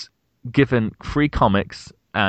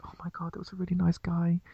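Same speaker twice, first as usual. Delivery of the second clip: high, mock gushing woman's voice; imagined smitten customer; 10 semitones higher, 4 dB quieter than usual. Imagining her telling friends about him, high mock gushing. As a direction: gush softly in a raised voice.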